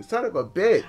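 usual speaking voice

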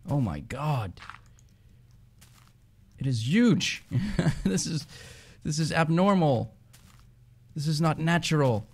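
high, astonished exclamations; real disbelieving wonder; amazed. Real, not acted. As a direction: marvel with rising pitch and a laugh. Amazed at huge mountain.

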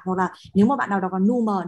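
usual speaking voice

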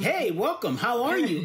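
high, cheery acted greeting; excited welcome. Developers greeting a newcomer to their virtual world, excited welcome.

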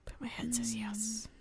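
slow whispered mock drama; dramatic whisper. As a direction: whisper it like a horror narrator. Dramatic inner monologue.

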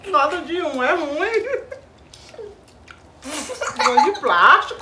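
chewing while talking; candy in mouth; mouth full of candy. Real, not acted. Seen 0: Hand at mouth, jaw working; she had just put candy in.

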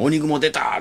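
high, excited grandma cry; excited exclamation. Retold or imitated.

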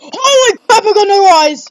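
loud, high shout of alarm; real spooked outburst; startled fright. Real, not acted. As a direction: yell out in sudden horror. Startled by creepy game screen.

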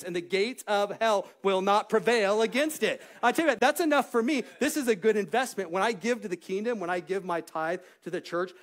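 loud, high, driving sermon delivery; rousing preacher's declaration; full-force preaching. Real, not acted.

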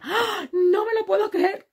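high, loud squeal of disbelief; mock shocked squeal. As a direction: ham up a stunned contestant who can't believe it. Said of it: "Y ella".

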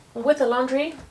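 ordinary talk, nothing marked

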